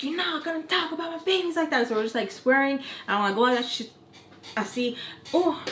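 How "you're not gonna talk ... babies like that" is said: pitched-up protective warning; defending her babies in the fight; high retold warning.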